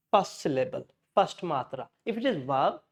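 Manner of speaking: normal talking voice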